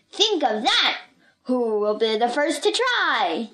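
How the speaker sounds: showman issuing a public challenge; circus ringmaster. Acted. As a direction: dare the crowd with stretched, punched key words.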